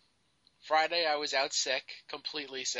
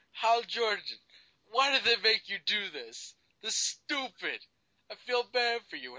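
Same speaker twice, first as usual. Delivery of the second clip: put-on drunk voice; 6 semitones higher than usual. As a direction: play a drunk guy, pitched up. Mocking drunk voice back.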